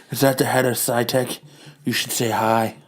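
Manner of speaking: low, soft put-on wife voice; a weary wife handling everything. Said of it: "wife line".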